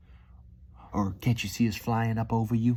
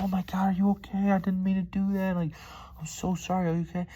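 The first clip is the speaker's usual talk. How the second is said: much higher, fast panicked apology to a hurt dog; scared apologetic owner; 11 semitones higher than usual. Retold or imitated. Driver imitating a frightened owner apologizing to his yelping dog. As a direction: gush worried sorries as if you just stepped on its paw. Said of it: "you get so sad and scared and you go be like".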